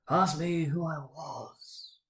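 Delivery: slow, hollow spectral drawl; ghost voice. A narrator's reading. Marley's ghost answers Scrooge, slow and hollow. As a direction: let each word hang like a dead man.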